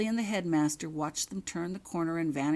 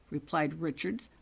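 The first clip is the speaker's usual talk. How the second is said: usual speaking voice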